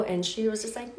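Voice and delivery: usual speaking voice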